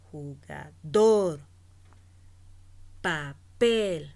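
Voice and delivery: slow Jugador, papel with loud, long DOR and PEL; stress on last syllable. Shown as a demonstration. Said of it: "se pronuncia en la última sílaba". (última sílaba fuerte y alargada: DOR en Jugador, PEL en papel)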